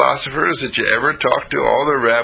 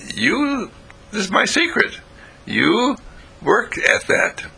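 1 confiding secret; 11 semitones higher, slower than usual. Acted. Jesus confides his secret.

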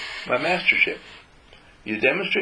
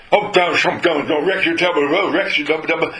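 higher and louder, teasing the dog; playful scolding of the dog; 6 semitones higher, 6 dB louder than usual. Retold or imitated. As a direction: tease the dog with a mock telling-off. Mock scolding the dog Rex.

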